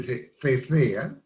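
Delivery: slow "se cecea" with th for the c's; ceceo. Shown as a demonstration. Explaining the zones like Margarita, slow th sounds. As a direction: draw out "cecea" with lisped th sounds. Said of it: "por ejemplo, en Margarita".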